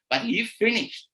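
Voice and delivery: ordinary delivery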